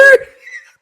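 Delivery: high, loud burst of laughter; a real shrieking laugh. Real, not acted. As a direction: break into a squealing laugh. Laughs at own quote.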